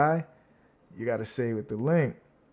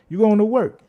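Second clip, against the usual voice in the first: higher and louder, punching going; hyped call-out; 8 semitones higher, 6 dB louder than usual. Real, not acted. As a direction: cheer him off to work. Hyping up a viewer.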